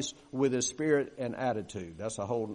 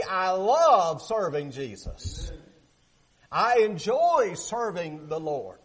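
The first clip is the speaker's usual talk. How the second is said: cheerful servant; 4 semitones higher, 4 dB louder, much slower than usual. Pretend. Modelling a cheerful servant's words.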